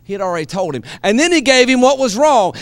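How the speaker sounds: ordinary talk, nothing marked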